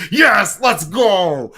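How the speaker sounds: high, loud eager yes; excited yes. Retold or imitated. Retelling his own eager reply, loud and excited. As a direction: jump at the chance to leave. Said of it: "And I was just like".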